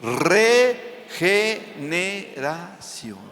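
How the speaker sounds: "regeneración" drawn out very slowly, each syllable spaced; "regeneración" said at a crawl, teaching it. Shown as a demonstration. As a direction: spell "regeneración" out slowly for the congregation to repeat. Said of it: "repita conmigo".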